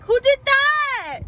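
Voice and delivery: imitating her mom's slow, high, loud "who did that"; mock surprised mom. Son mimics mom asking who made the sign, high and slow. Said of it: "she came to the car and she was like".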